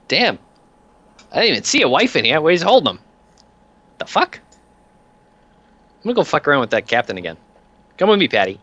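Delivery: breezy heavy who takes charge; offhand mob enforcer. Acted. Peanuts remarks, then fetches Patty.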